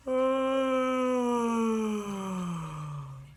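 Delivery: long, low, drawn-out yawn; a long lazy hippo yawn; slow hippo yawn. Shown as a demonstration. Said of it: "a slow, low yawn".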